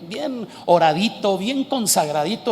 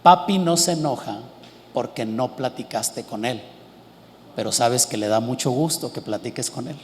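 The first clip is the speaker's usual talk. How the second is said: tender father; 9 semitones lower, 7 dB quieter than usual. Retold or imitated. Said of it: "en mi regazo y le dije".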